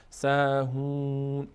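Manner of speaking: "سهون" slowly with a stretched oo; long oo vowel. Shown as a demonstration. Teacher shows damma before waw, long oo. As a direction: draw out the oo in "سهون".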